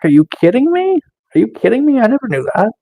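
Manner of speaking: high-pitched, playful disbelief; mock amazement. Streamer pretending amazement to his chat friend Nick, mock amazement.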